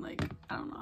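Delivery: real nervous whisper; whispered nerves. Real, not acted. Vlogger nervous before doing eyeliner, whispering. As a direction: whisper it, scared of the liner. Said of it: "I'm scared".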